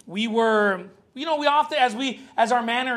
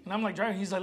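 usual speaking voice

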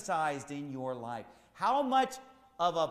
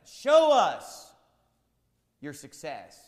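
mock demand; 10 dB louder, much slower than usual. Preacher voices carnal people demanding proof of success. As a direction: throw the crowd's demand back at them. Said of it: "they want us to show them".

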